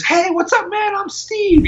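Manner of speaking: put-on very high squeaky young boy. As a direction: pitch way up like a squeaky twelve-year-old. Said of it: "introduced himself with a squeaky voice".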